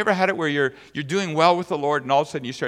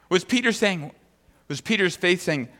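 usual speaking voice

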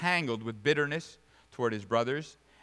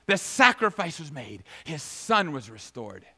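emphatic proclamation; 3 semitones higher, 6 dB louder than usual. Real, not acted. Preacher declaring the son restored, emphatic proclamation. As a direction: proclaim it, then ease down to a close. Emphatic repeat after the shouted command.

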